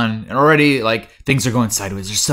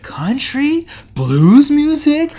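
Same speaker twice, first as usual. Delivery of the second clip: much higher, slow, louder; mock bafflement; 5 dB louder, much slower than usual. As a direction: drag out the clueless kids' questions. Kids baffled by dance styles.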